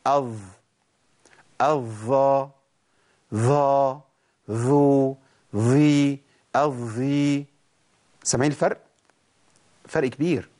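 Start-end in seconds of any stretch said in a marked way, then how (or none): 0.1-7.4 الض الظ ظا ظو ظي الظي with the tongue pushed out past the teeth; wrong ظاء. Shown as a demonstration. Teacher shows students the wrong tongue-out ظاء. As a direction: demonstrate the mistaken sound. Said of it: "اسمعوا كده".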